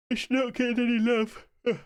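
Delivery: talking through a yawn; words stretched by a big yawn. Real, not acted. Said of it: "Sorry, yawn there".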